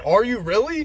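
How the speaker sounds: high, loud mocking "really"; incredulous jab at mom; sarcastic retort. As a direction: throw it back at her with disbelief.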